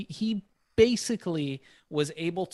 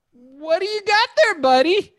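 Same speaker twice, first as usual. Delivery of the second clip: stranger's voice; 8 dB louder than usual. Retold or imitated.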